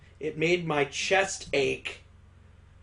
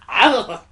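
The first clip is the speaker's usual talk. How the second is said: high, loud startled yelp; sudden high cry of shock; 7 semitones higher, 10 dB louder than usual. Acted. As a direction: yelp as if jolted down the spine. Jolt from breath in her ear.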